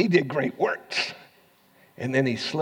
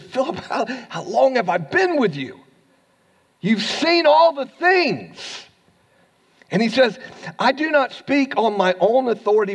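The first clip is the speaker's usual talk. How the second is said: preaching at full force; 8 semitones higher, 5 dB louder than usual. Acted. Pastor preaching Jesus' words to the church, at full force. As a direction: raise the voice high and preach it out.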